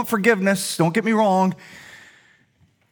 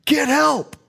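retold scream for help; 8 semitones higher than usual. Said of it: "And so I scream".

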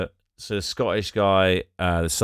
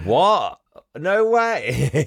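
mock shock; 7 semitones higher, 3 dB louder, much slower than usual. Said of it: "he's like".

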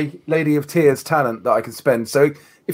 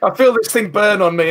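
ordinary delivery

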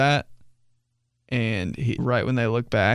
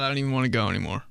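rushed groan; 5 dB quieter, much faster than usual. Retold or imitated. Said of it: "you're like".

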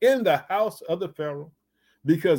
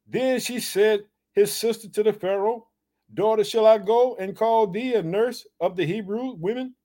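lifted, measured Bible reading; scripture reading; 5 semitones higher than usual. Acted. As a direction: read the passage with weight. Preacher reads the scripture.

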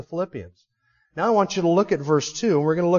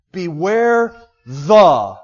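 "Beware the" stretched very slowly and loud; drawn-out warning; 7 dB louder, much slower than usual. Shown as a demonstration. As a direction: hold "Beware the" long and heavy. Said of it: "adds to the rhetorical force".

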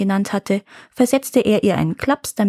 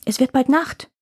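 higher, impatient warning; impatient urging; 4 semitones higher than usual. A narrator's reading. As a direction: urge them on, pitch rising. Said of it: "rief der Bauer ungeduldig".

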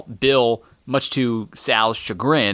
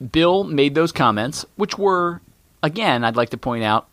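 usual speaking voice

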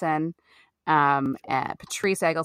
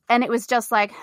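ordinary delivery

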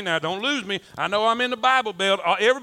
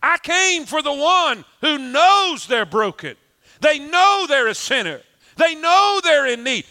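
impassioned preaching; 7 semitones higher, 5 dB louder than usual. Acted. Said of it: "And Jesus is saying".

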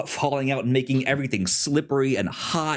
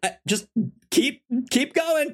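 breezy Benji; 13 semitones higher than usual. Retold or imitated. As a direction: coax him on without a care. Benji unbothered.

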